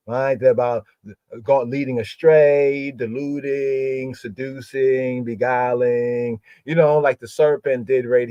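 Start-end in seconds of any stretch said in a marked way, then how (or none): 1.7-6.4 slow, stretched word endings; lingering on every item of the list; drawn-out list. Shown as a demonstration. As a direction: draw each word out, one after another.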